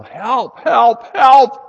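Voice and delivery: panicked call for help, building to a full shout; yelling for help. Retold or imitated. Man in gorilla suit yells for help in lion's den, rising shout. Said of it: "he started to yell".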